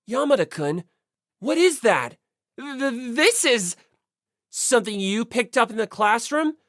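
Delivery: stunned at finding the box; stammering surprise. Acted. Naegi spotting the box, lightly voiced stammering surprise.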